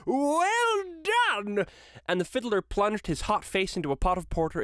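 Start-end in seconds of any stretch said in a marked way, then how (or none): 0.1-1.7 put-on jovial old master crying out, far higher; hearty praise to the dancers; cried out. A narrator's reading. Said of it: "cried out".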